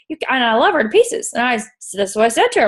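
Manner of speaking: ordinary talk, nothing marked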